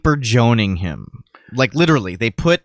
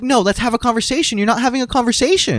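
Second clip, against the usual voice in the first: much higher, indignant mimic; hammed-up indignant woman at the rally; mock lady; 12 semitones higher, faster than usual. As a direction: squeak it like the offended lady. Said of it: "she's like".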